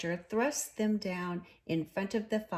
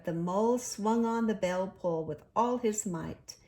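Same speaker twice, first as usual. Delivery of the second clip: usual speaking voice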